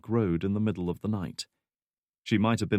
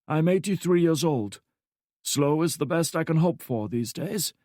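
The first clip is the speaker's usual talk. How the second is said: put-on higher, dry and amused old man; wry old man; 8 semitones higher than usual. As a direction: tease her gently with wry calm. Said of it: "This prompted a wry smile".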